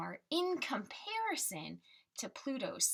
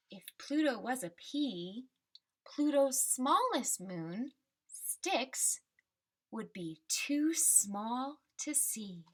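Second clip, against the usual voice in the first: put-on reading to children; 3 dB louder, slower than usual. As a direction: ease the ending down gently, like the book's last page. Teacher reads picture book aloud.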